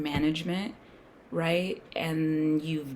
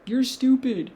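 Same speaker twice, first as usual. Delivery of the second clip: higher, louder sneering mock jeer; mocking critics; 7 semitones higher, 9 dB louder, slower than usual. Mocking everyone who would call him stupid, loud higher sneer. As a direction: jeer it like the whole crowd scoffing. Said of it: "everybody would be like".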